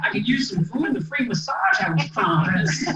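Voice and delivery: laughing through a cheeky request; joking to the doctor while laughing. Retold or imitated. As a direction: chuckle while asking for food and a massage. Laughing retort in medical tent.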